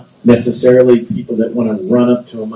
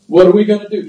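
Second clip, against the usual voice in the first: acted fretting, floor-pacing worrier; hammed-up worried questioning; mock worry; 4 semitones higher, 3 dB louder, faster than usual. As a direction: play someone anxiously pacing. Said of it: "he's not worried".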